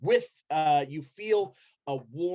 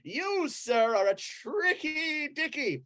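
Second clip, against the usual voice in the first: high, hearty preacher's amazement; pitched-up, jolly cult brother; delighted Brother Flufferkin; 11 semitones higher than usual. Acted. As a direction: exclaim with a big acted laugh. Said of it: "Brother Flufferkin goes".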